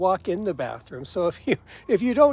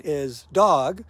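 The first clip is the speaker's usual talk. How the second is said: usual speaking voice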